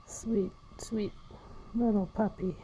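soft whisper to a puppy. Real, not acted. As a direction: breathe it softly.

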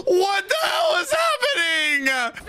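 high, slow, stunned outcry; shocked cry. Real, not acted. Watching the destruction, a high stunned cry. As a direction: let the disbelief pour out.

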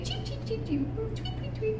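high, soft playful tweeting; bird chirps. Shown as a demonstration. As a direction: chirp like a little bird for a child. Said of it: "What's the bird say?".

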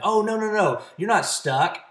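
high, eager insistence brushing off the worry; pushy reassurance. Acted. Salesperson arguing with a prospect, high and insistent. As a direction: jump in to argue them out of it. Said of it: "trying to talk them out of or convince them".

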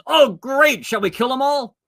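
high, loud, gleeful king; bloodthirsty delight at the chance; eager king. Retold or imitated. King of Israel asks Elisha to kill the army, high gleeful eagerness.

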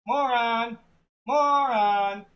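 loud call across the house. Acted. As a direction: call his name, searching for him. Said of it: "calling his name".

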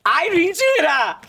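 high, drawn-out cry of disbelief; shocked disbelief. Pretend. Host receiving a surprise gift, shocked disbelief. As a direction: shriek it as if you can't believe it.

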